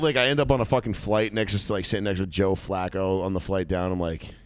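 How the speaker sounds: ordinary delivery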